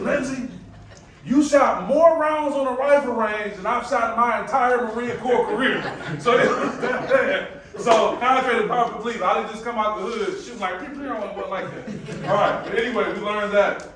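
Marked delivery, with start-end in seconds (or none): 0.0-5.8 staff sergeant. Retold or imitated. Staff sergeant voiced in story. 10.8-11.1 gunshot sounds. Retold or imitated.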